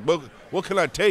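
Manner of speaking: lightly voiced eager players; a hint of pushy teammates begging; mock players asking. Former quarterback imitating players asking him for pills, lightly voiced.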